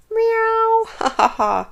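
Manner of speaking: high, loud cat laughter; meowing laugh. Acted. Cat jeering at Shelley, meowing laughter.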